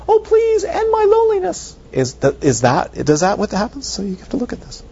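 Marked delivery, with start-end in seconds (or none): 0.1-1.8 high, loud hammed-up whine; screaming emotion. Teacher mocks an emotion for students, high loud whine. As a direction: wail it like a melodramatic feeling. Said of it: "Or does it go".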